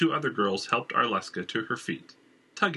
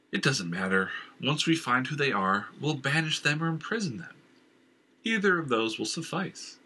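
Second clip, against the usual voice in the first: a king dismissing his inner voice, rising in pitch; firm and a little higher, settling the matter; hint of a commanding king; lightly voiced stern king; 6 semitones higher than usual.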